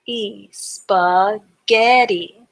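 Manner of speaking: "spaghetti" drawn out very slowly; slow blended word. Shown as a demonstration. Teacher slowly blending the syllables into spaghetti. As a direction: stretch "spaghetti" as you blend it. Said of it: "break the word up into syllables".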